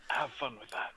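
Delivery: whispered, very soft; hushed parting quip; wry aside. Acted. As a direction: slip it in under your breath. Wry muttered aside in game.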